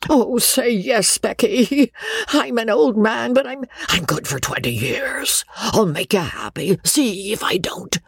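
put-on an old man pleading for her return; pleading old baronet. A narrator's reading. Old man begs on knees.

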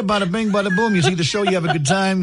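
a hint of a mobster Italian accent; mock Italian accent. Radio host hamming an Italian accent for the contest. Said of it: "we doing Italian accents".

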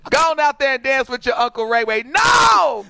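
a loud, fast brush-off ending in a shouted no; loud refusal. Retold or imitated. Brushing off the aunt who pushes her to dance, a loud shouted no. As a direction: shove him off at full volume.

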